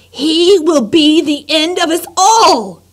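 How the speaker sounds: high, loud, slow shout. Acted. Lester shouts at his wife about his son, high slow shout. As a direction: bellow the doom at her. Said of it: "he shouted".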